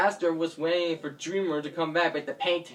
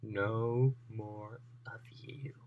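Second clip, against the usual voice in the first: slow, low villain menace, sinking into a whisper; gloating villain; 5 semitones lower, slower than usual. Acted. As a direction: threaten slowly, dropping to a whisper. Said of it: "Gracia is starting to laugh, and she said".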